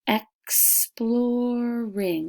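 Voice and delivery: slow, drawn out, beat out each syllable of exploring; ex-plor-ing, slow and sounded out. Shown as a demonstration. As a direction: stretch exploring into its three beats. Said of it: "listening for the syllables".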